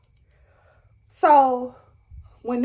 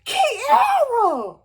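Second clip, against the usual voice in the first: high wailing cry of a friend's name; hammed-up sobbing call, pitched way up; 12 semitones higher than usual. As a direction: wail the name as if in tears. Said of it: "I started crying".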